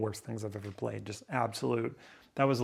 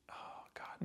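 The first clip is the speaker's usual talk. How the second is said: whispered despair; 19 dB quieter than usual. Real, not acted. Despairing rant.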